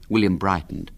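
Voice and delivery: ordinary delivery, nothing marked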